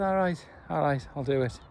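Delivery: softly put-on, clumsy Irish accent; bad Irish accent. Camper retelling a footballer agreeing to an interview, bad Irish accent. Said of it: "in my worst Irish accent".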